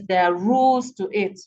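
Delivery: usual speaking voice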